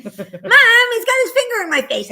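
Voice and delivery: put-on high, loud, slow childish whine; whiny tattling little sibling. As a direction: tattle to mom like a wronged kid. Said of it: "it's a typical".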